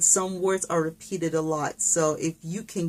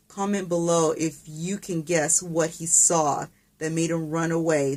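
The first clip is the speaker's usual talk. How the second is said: ordinary delivery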